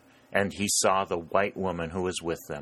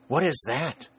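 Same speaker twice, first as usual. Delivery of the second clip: higher-pitched, startled question; exclaiming; 7 semitones higher than usual. A narrator's reading. Mother on the battlefield hears a distant voice, exclaiming. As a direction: exclaim it with a sharp lift in pitch. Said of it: "exclaimed Missus Mason".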